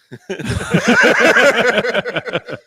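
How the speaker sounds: big high burst of laughing; loud real laughter. Real, not acted. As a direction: crack up at your own story. Laughing at own story.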